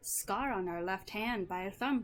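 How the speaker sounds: put-on wavering, amateur Irish accent; cheerful Irish-accented halfling reminiscing. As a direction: spin a tale in a loose Irish accent. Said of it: "I'm trying my best to do an Irish accent".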